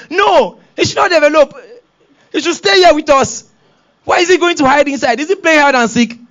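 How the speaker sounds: put-on a kid refusing to accept it; protesting child. Child protests about baby.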